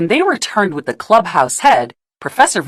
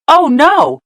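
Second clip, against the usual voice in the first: higher, louder alarmed exclamation; 10 semitones higher, 5 dB louder than usual. A narrator's reading. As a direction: gasp it out in alarm. Narrator exclaims at danger.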